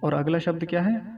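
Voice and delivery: usual speaking voice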